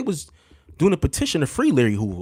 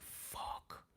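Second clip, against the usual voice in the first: an unvoiced, barely audible curse; frightened whisper; 28 dB quieter than usual. Going down the dark stairs, frightened whisper. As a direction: breathe the word out in dread.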